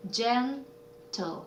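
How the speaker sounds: "gentle" split into gen, pause, tle, softly; syllable by syllable. Shown as a demonstration. Teacher sounding out a word, "gentle" in two separate syllables. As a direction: pull "gentle" apart slowly and softly. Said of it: "요거는 silent 이였고요".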